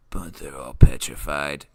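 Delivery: low, forceful stage whisper; ominous reveal. Acted. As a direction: drop low for a mock-spooky reveal. Ominous reveal in imagined game scene.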